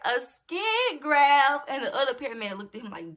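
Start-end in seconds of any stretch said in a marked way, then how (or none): 0.1-1.7 loud, drawn-out incredulous question; higher and louder, stunned echo; shocked disbelief. Retold or imitated. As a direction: stretch it out in alarmed disbelief. Repeats alarming news back in disbelief.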